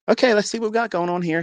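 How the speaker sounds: high-pitched sing-along nurse patter; chirpy nurse. Retold or imitated. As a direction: imitate a nurse starting an exam, pitch way up. Nurse voiced starting the sonogram.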